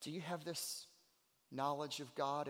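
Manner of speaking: ordinary talk, nothing marked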